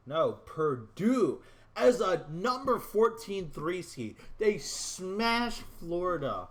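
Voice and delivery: loud, high, drawn-out exclamation; stunned announcement. Real, not acted. Gamer announcing to viewers that Purdue won the title, loud and stunned. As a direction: punch out the upset result in disbelief. Announcing a shock championship result.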